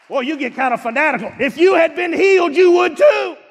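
loud, high shouted preaching; full-force preaching. Acted. Preacher shouting to the congregation about healing, full force.

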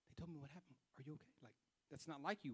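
soft, worried caring voice; concerned. Retold or imitated. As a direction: gently check on a friend, hushed. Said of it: "she was so concerned".

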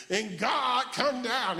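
drawn-out, high revival preacher chant; hooping prayer. Retold or imitated. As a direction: imitate a whooping church prayer. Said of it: "we had hooped when we prayed".

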